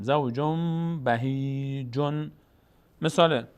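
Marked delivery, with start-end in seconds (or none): 0.0-2.3 زوجم بهیجن very slowly, long m and long i; n turned to m. Shown as a demonstration. Teacher models the full reading, زوجم بهیجن slow with held m. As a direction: hum زوجم into a drawn-out بهیجن.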